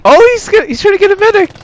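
high excited yelling; excited shout. Real, not acted. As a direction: yell it out in disbelief. Teammate runs to grab medic.

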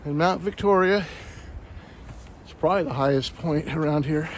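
usual speaking voice

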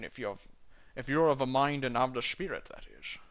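Scottish burr, rolled and heavy, dwarf in a tavern; a Scottish dwarf doing his best Connery; Sean Connery impression. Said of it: "do a Sean Connery impression".

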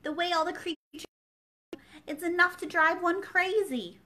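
building to a frustrated cry; Alice losing patience with a silly footman; exasperated. A narrator's reading.

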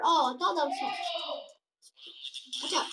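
ordinary talk

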